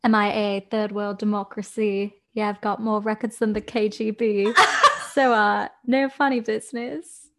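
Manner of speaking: attempted M.I.A.-style British accent. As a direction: do your best British accent, quoting lyrics. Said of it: "Give me your best British accent".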